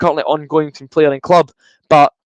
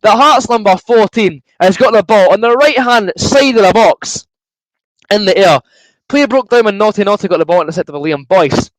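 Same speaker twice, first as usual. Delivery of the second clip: fast-building match commentary, higher and louder; live play-by-play; 6 semitones higher, 4 dB louder than usual. Acted. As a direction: call the replay like a TV commentator.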